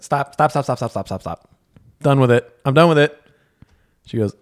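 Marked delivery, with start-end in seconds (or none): 0.0-1.5 mock panicked stop. Retold panic attack in therapy. 2.0-3.2 louder, insistent cutoff; loud flustered refusal; retold loud refusal. Retold panic attack in therapy.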